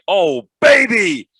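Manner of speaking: quoted commentator's catchphrase, high, loud and stretched; mock-excited commentator cry. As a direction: belt it out slowly like a big play call. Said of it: "every time you get excited".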